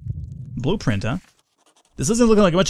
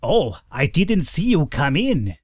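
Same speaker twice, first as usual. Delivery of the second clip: character voice; 2 semitones higher, slower than usual. Acted. Voicing game character.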